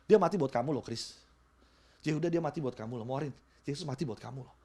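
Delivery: quietly naming each listener; soft personal appeal. Acted. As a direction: lean in and speak to one person. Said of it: "addressing individuals softly".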